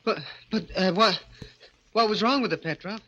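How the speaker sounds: high, nervous stammering objection; anxious protest. Acted. Dwarf protests to his master.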